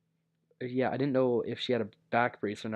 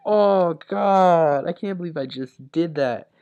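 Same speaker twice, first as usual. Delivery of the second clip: louder, higher groan of disbelief; mortified; 5 semitones higher, 10 dB louder, slower than usual. Retold or imitated. After hugging the wrong sister, loud mortified outburst. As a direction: cry out in embarrassment. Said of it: "So I'm like".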